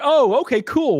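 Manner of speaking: mock cheery agent. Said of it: "So PayPal was like".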